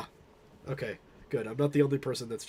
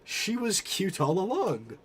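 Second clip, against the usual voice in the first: sudden realization; 6 semitones higher, 4 dB louder, slower than usual. Acted.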